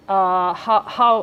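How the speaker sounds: loud startled disbelief; a sharp loud double how; incredulous how. Retold or imitated. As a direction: ask it as if it makes no sense. Incredulous retold question.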